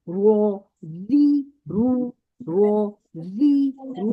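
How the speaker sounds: slow, clear रो री रू, heavy then light; careful ra demo. Shown as a demonstration. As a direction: model रो री रू for students to copy. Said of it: "देखिए मेरी आवाज गौर से सुने". (साफ़ साफ़ रो री रू)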